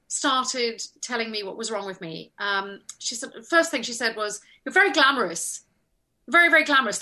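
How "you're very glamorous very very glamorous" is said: mock disapproval, louder on the repeat; critical costumier. Costumier tells actress what's wrong with her, critical. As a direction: pronounce judgement as the fussy costumier.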